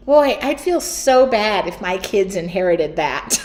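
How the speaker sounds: normal talking voice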